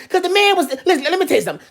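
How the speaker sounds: ordinary talk, nothing marked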